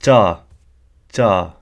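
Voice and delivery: "cha" rising in pitch at the end. Shown as a demonstration. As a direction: Punjabi "cha" with the tone lifted at the end. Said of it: "Let me say it".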